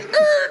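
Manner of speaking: very high skidding squeal; imitated tire screech. Cop sliding up to park, imitated screech. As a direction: screech like brakes locking up.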